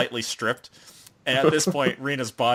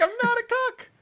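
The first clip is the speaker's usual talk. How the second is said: mock frantic denial. Boy protests his innocence to onlookers, mock frantic denial. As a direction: squeak out a hammed-up denial. Boy's protest acted out again.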